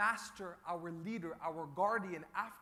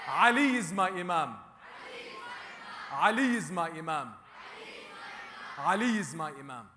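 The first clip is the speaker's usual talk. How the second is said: firm declarations, louder; loud call and response; 2 semitones lower, 5 dB louder than usual. Shown as a demonstration. Preacher leading the congregation's creed, loud. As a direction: lead the crowd in the creed.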